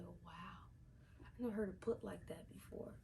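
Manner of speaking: soft breathy whisper of amazement; mock awed whisper. Vlogger recalling her reaction to Karina's line, whispered. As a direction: hush it like it's a revelation.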